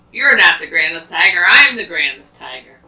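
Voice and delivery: arguing tiger. Acted. Third tiger shouts down the other two, loud and boastful. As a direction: top them both, loudest claim. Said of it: "they're arguing".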